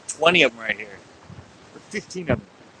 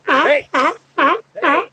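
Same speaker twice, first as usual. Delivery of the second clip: high-pitched teasing holler; sharply higher, playful call; 12 semitones higher, 4 dB louder than usual. Pretend. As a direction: shout to get an animal's attention. Calling out at sea lions.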